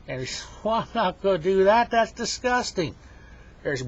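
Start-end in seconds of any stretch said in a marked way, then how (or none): 0.6-2.9 high, loud, indignant refusal; appalled protest. Acted. Henry refuses the bear's demand, appalled and high-pitched. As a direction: squeal out your disgust in protest.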